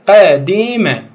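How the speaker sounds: قديمة stretched very slowly and loud; slow and drawn out. Shown as a demonstration. Teacher stretching the word to show the vowel. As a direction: drag out قديمة to show the kasra. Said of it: "قدم".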